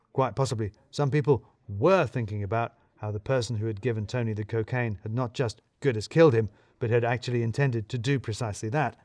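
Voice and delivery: usual speaking voice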